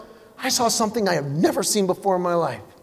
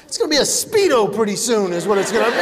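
normal talking voice

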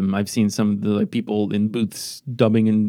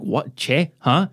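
mock confusion; 3 semitones higher, slower than usual. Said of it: "everyone's gonna be like".

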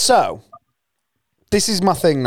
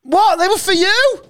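high, stunned "what, they were for you?"; shocked disbelief. Real, not acted. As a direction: react in real disbelief. Friend learns gift was given away.

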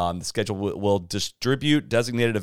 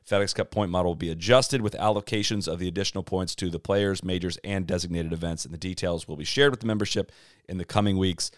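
normal talking voice

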